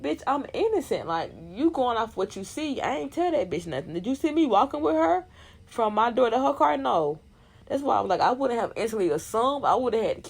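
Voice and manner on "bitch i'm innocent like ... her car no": 1 indignant denial. Retold or imitated. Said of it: "But then I also said".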